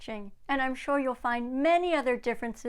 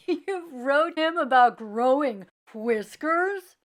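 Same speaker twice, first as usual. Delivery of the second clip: mocking question; 3 semitones higher, 6 dB louder, slower than usual. Brother mocks the letter.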